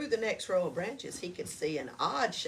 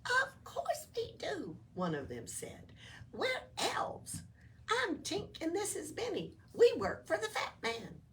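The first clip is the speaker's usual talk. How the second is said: high, chirpy little elf voice; cheerful elf; 11 semitones higher than usual. Acted. Elf explaining to Oscar, high and bouncy. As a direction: introduce yourself like a perky elf.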